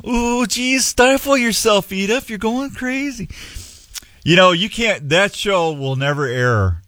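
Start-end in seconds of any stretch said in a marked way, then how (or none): 0.1-3.3 Archie Bunker voice. Retold or imitated. Archie catchphrase impression.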